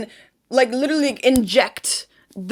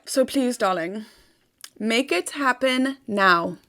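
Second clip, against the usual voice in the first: softer, slower coaxing; tender plea; 5 dB quieter, slower than usual. Real, not acted. Coaxing her viewers to act now, softer and slower. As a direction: coax them gently, slowly.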